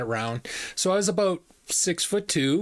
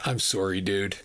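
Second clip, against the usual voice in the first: lightly voiced low apology; a low, resigned word to the other guy; apologetic rig worker; 8 semitones lower than usual. Rig worker to the manager's employee, mildly apologetic.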